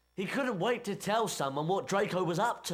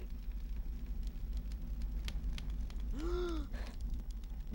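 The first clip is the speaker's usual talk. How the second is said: long breathy gasp of surprise, pitched up; 10 semitones higher, 8 dB quieter than usual. Acted. Harry sees what Ron found and gasps in amazement.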